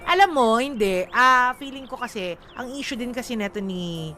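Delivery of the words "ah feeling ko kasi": ordinary talk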